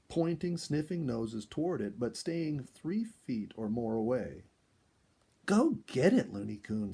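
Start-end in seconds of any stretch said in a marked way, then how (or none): 5.5-6.3 loud, high urging call. A narrator's reading. Sonia urging the raccoon toward the melon, loud and high. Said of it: "Sonia urged".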